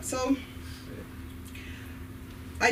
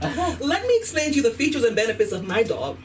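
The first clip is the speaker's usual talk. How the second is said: high-pitched, insistent mock dog owner; hammed-up owner showing off a pet; pushy dog owner; 5 semitones higher than usual. Dog owner explaining their pet to a frightened stranger, pushy mock voice. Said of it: "they wanna explain to me".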